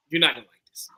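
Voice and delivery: hushed teasing; whispered warning. Real, not acted. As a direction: whisper the tease.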